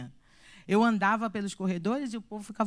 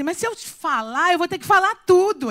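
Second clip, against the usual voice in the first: rising alarm; 7 semitones higher, 8 dB louder than usual. Retold or imitated. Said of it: "E eu pensei assim".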